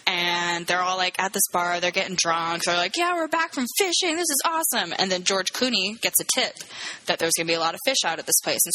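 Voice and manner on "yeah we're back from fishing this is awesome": high-pitched, excited retold cheer; mock cheering. Drunk fishermen at the bar, mock cheering. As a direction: imitate a crew celebrating.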